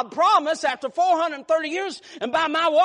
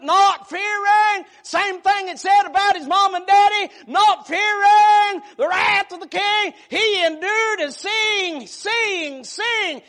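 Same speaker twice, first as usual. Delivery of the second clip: shouted, high sermon climax; whooping preacher crescendo; preaching at full force; 5 semitones higher, 6 dB louder, slower than usual. Real, not acted.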